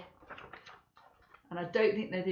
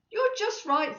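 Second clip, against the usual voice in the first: put-on child's voice; 12 semitones higher, 3 dB louder than usual.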